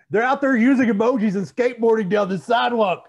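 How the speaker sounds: put-on high, loud old-man rant while laughing; laughing mock old geezer; cranky old man rant. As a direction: complain about kids on skateboards. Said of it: "crouchety old man".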